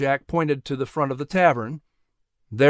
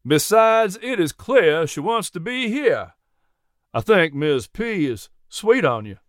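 higher-pitched Southern gentleman, playfully refusing; kindly horse owner, amused; 4 semitones higher than usual. Acted. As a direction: play a genial Southern farmer. Jack's character voice in story.

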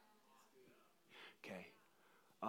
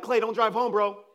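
raised, higher voice of alarmed friends; retold warning; 7 semitones higher, 8 dB louder than usual. Friends warning a dizzy Clay off driving, raised voice. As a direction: call out a mock warning.

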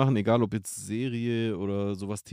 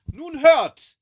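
high, loud, theatrical declaiming; hammy stage actor; 5 dB louder than usual. Retold or imitated. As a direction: overblown old-style stage speech. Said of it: "Theater ist halt so theatralisch".

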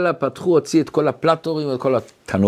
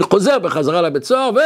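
ordinary talk, nothing marked

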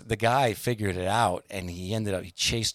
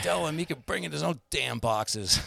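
high, exasperated rant, not full volume; retold angry outburst; 7 semitones higher than usual. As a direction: imitate the fed-up boss once more. Repeating the manager's outburst.

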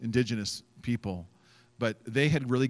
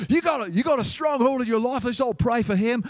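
loud, high Australian preacher rallying the crowd; 12 semitones higher, 6 dB louder than usual. Retold or imitated. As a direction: call out across a crowd in mock Australian. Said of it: "this, this Australian guy".

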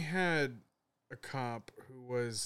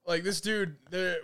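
ordinary talk, nothing marked

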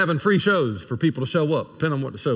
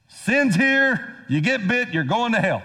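high, emphatic pronouncement falling at the end; blunt verdict; 7 semitones higher than usual. Acted. What God didn't just say to sinners, blunt high-pitched pronouncement. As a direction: pronounce it sharply, starting high.

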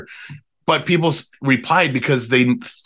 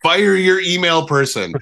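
mock yell; 8 semitones higher than usual. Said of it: "yell at them".

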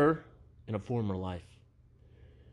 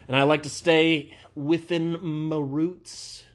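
mock country twang; 6 semitones higher, 5 dB louder, slower than usual. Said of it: "more of a country twang to it".

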